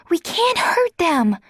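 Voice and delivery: high, slow urgent cry; urgent plea. A narrator's reading. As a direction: hold her back with a plea. Emily grabs Adrian's arm.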